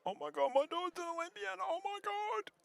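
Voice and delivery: hammed-up very high, quick mum squeal; proud, passionate mum. Commentator stirring Prue with her mum's reaction, squealing high. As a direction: put on an overjoyed mother's squeal. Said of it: "I can imagine your mum's reaction".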